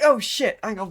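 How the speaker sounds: ordinary talk, nothing marked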